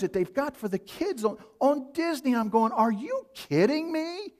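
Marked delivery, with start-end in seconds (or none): 2.7-4.3 pitched way up, slow disbelief; shocked disbelief. Real, not acted. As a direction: sound truly stunned at what you saw. Shocked at Disney shows.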